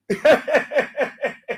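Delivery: rhythmic, drawn-out chuckle; laughing. Real, not acted. Host after saying he is not authoritative, chuckling.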